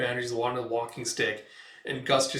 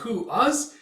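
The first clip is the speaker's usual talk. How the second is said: high, startled question; a small voice asking; surprised squeak; 10 semitones higher, 4 dB louder than usual. Retold or imitated. Companion surprised at being called, high question. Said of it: "He's like".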